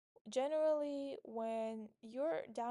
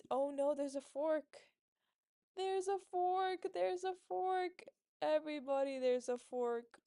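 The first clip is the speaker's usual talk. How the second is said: mock alarm; 4 semitones higher, 5 dB louder than usual. Real, not acted. Chess streamer warning everybody about a fork, loud mock alarm. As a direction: raise the alarm, stretching each word out.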